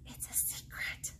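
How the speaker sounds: breathy secret whisper; hushed storybook whisper. Acted. Reading secret ballot line.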